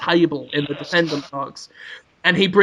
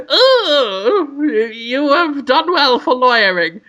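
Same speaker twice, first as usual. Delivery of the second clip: doting wife; 7 semitones higher, 3 dB louder, slower than usual. Acted. Wife voiced in story.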